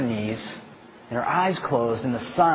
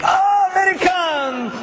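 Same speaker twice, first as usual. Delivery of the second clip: high, loud, hammed-up shouts of welcome; excited Japanese hikers; 3 dB louder, slower than usual. Japanese hikers in the shack welcome the Americans, hammed-up shouting. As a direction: ham up the eager hosts calling out.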